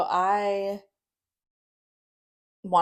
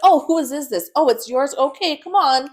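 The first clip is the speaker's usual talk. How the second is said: high, loud mock-friendly voice; sarcastic mimicry; 8 semitones higher, 10 dB louder than usual. Authorities excusing drugs in the house, sarcastically cheery. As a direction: exaggerate a breezy, easygoing official. Mocking imagined lenient authorities in argument.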